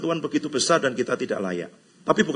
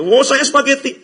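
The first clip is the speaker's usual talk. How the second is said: high, louder mock reply; mock chatter; 11 semitones higher, 10 dB louder than usual. Preacher mimics another diner, high and teasing. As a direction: say it like a smug picky eater. Mimicking people naming noodle brands.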